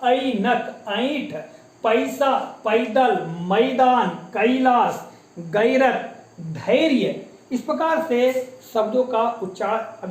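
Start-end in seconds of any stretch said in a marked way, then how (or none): 0.0-7.2 each word apart, ऐ opened into 'a-i', as in पैसा 'pa-i-sa'; diphthong demonstration. Shown as a demonstration. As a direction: stretch the 'a-i' glide in मैदान and कैलाश. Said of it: "जबकि क्या है ये ऐ है".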